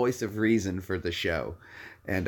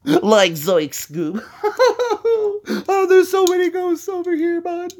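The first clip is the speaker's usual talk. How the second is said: much higher, scared stoner voice; high shaky Shaggy; Shaggy impression; 4 dB louder than usual. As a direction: cry out like a jittery slacker. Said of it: "I can do Shaggy".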